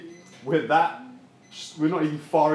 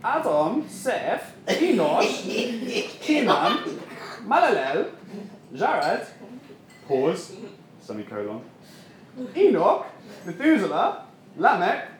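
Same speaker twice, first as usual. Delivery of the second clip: higher, lilting mock solemn list; droning Bible reading; 6 semitones higher, much slower than usual. Preacher mocking a dull Swedish service, chanting the names. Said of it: "a typical Swedish service now".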